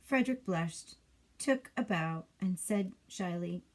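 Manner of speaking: plain reading voice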